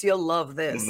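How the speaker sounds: a hint of a low ad announcer; lightly voiced ad. Mocking YouTube ad, lightly low announcer. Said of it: "like, if you like this".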